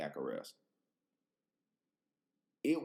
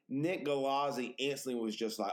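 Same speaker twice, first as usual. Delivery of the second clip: normal talking voice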